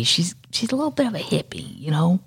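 hushed aside, half secret; confiding whisper. Real, not acted. Gossiping to listeners about Stevie in a hushed whisper.

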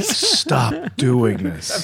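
very slow, drawn-out plea; mock plea. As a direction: stretch every word in mock exasperation. Mock plea from accent haters.

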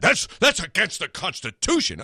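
a light mock take on the advocacy group's outrage; mocking the protest group. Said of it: "they probably didn't say it that way".

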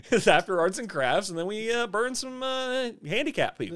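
high, sing-song chirpy evil; chirpy villain voice. Pretend. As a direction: chirp it out like daily routine. Playing the show's cheerful villain.

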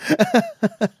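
a big, amused laugh; imitated laugh. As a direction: laugh it off like the question is silly. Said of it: "And he just went".

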